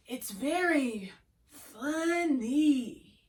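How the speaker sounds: laid-back California surfer drawl, slow stretched vowels. Shown as a demonstration. Teacher's second Chocolate Bunny example, slow California surfer accent. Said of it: "That was my California surfer".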